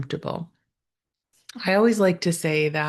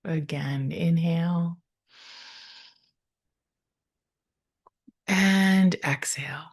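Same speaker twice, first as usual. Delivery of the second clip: guided meditation. Real, not acted. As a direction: ease them into stillness, leaving space after each phrase.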